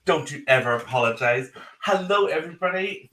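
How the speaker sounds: usual speaking voice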